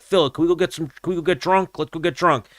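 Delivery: high, coaxing friend voice; imitating a friend begging him to leave; pleading buddy. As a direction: talk him down, wheedling and high.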